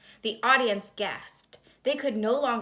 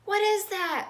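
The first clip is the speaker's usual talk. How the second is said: much higher, louder cry of alarm; scared yell; 12 semitones higher, 4 dB louder than usual. A narrator's reading. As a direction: shriek it out in fright at the monster. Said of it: "Lucy yelled".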